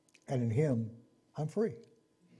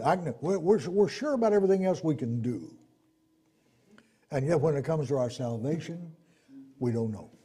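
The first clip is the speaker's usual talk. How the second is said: ordinary talk, nothing marked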